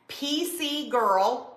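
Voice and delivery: put-on slow, careful dictation of P C Girl; spelled out slowly. Shown as a demonstration. Host telling viewers what to type, said slowly. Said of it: "or you can type".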